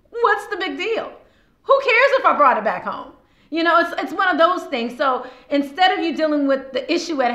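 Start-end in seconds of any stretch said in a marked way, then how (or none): 0.1-5.0 mock defensive retort. Other side's defensive retort.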